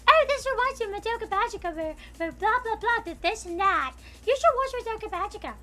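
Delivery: high, fast mock gush; mocking fangirl voice. Streamer mocks his ginger classmate, high fast mock gush. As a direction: parody her eager pitch. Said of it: "she's like".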